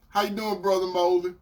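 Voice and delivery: usual speaking voice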